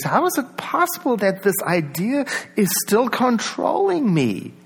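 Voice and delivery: high-pitched, incredulous self-questioning; acted disbelief. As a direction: pitch it high in bewilderment, dropping on the last word. Acting out disbelief at oneself.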